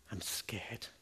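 low, hushed admission of fear; quiet and low, imitating someone scared; retold frightened confession.

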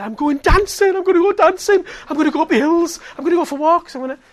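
very high, loud jubilant cries; overjoyed imitation. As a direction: act out her bursting joy, shouting. Healed woman rejoices over life back.

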